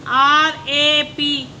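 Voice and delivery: slow letter-by-letter spelling. Shown as a demonstration. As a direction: spell A P slowly, each letter apart. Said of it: "teacher spells word slowly".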